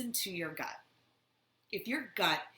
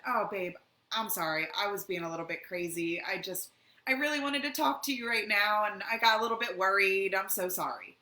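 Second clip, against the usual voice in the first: louder, gushing mock apology; smooth it over with a sugary sorry; pleading apology; 2 semitones higher, 6 dB louder than usual. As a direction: abuser sweet-talking after the blowup. Acting out abuser's apology.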